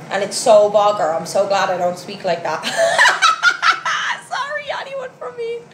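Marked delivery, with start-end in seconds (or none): 2.7-4.2 high, rapid cackling laugh; burst of laughter. Real, not acted. Laughing at own Meath remark. 4.3-5.7 high, soft words through laughter; laughing apology. Real, not acted. Laughing apology after mocking Meath.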